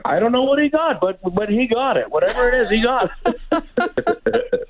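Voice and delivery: high-pitched imitation of a cheering stranger; amused mock bystander, breaking into laughter; excited bystander. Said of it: "and some guy's like".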